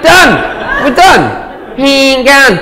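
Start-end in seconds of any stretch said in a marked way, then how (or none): 1.8-2.6 "Hang on" high on HANG, dropping on "on"; sing-song "Hang on" in two pitches, high then falling; two tones. Shown as a demonstration. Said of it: "Two tones".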